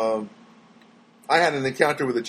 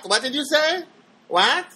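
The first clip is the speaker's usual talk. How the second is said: much higher, cartoonish confused voice; mock baffled listener; 11 semitones higher than usual. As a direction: play dumb and squeak out the question. Mocking baffled message listeners.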